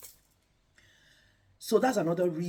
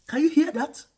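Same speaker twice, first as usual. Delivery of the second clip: ordinary talk, nothing marked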